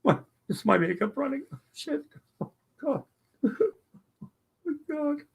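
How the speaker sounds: high crying voice, dabbing at makeup. Real, not acted. Streamer weeping at a wedding video, high and tearful. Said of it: "I promised I wouldn't cry again".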